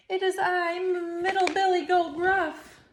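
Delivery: high, stammering goat voice; frightened goat. Acted. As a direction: stammer it out, terrified. Goat shaking with fear.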